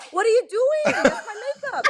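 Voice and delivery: high, loud, laughing outcry; mock horrified yelp over the lost makeup; shocked protest. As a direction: squeal it in disbelief.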